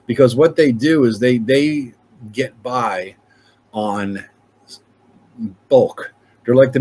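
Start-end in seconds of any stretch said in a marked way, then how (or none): none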